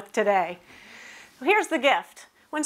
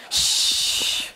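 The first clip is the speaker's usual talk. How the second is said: shushing. Real, not acted. Quieting the room again.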